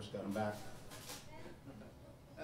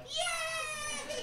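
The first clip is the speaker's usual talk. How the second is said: put-on very high squealed child cheer; cheering kids. Kids told no more gold bread, high-pitched cheering. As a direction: mimic little kids' shrill hooray.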